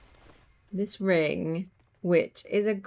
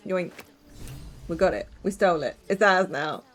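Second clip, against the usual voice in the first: loud, gleeful crowing with a laugh; gleeful triumph; 2 semitones higher, 6 dB louder than usual. Real, not acted. Streamer gloating at the game over her theft, loud and gleeful. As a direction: crow loudly over the stolen loot. Gleeful after stealing the idol.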